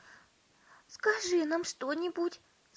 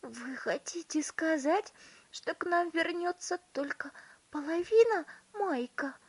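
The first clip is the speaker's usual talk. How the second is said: high-pitched, appalled question; horrified mother; 11 semitones higher, 4 dB louder than usual. A narrator's reading. Mother fearing half her son returns, horrified. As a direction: ask it in tearful horror. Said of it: "ужаснулась миссис Тиви".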